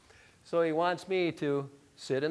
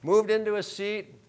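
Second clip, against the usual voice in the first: higher, louder shame on himself; self-rebuke; 7 semitones higher, 9 dB louder than usual. Real, not acted. Pastor loudly rebuking himself before the church. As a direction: scold yourself out loud.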